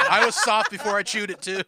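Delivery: laughing. Real, not acted. Teasing echo, laughing.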